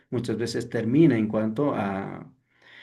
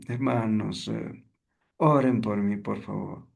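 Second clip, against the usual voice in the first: acted downcast, as if nursing a toothache; sad, pained; 2 semitones lower than usual. Shown as a demonstration. Teacher mimics someone asking the brethren for prayer, sad. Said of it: "Que está triste".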